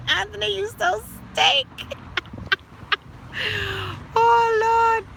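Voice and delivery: high-pitched, cracking up; talking through laughter. Real, not acted. Host teasing Anthony over his comment, high and laughing. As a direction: squeal the words out between laughs.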